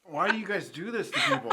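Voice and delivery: high, laughing through the burn; laughing in pain. Real, not acted. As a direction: laugh while begging for mercy.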